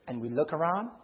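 ordinary talk, nothing marked

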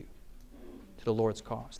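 slow whisper; soft hushed reply; whispered answer. Real, not acted. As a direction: breathe the answer slowly.

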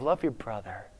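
soft, hushed pastor teaching love; quiet, tender sermon voice; gentle preaching pastor. Acted. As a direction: speak softly, like a pastor teaching kindness. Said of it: "the pastor's talking about love".

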